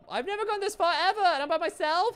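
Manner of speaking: high, loud despairing whine; crying with rage. Real, not acted. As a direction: wail it like you're about to cry.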